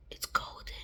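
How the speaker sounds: soft unvoiced whisper. Real, not acted. Vlogger whispering adrenochrome's color to viewers. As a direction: hush it as a reveal.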